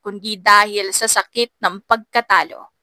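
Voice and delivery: ordinary delivery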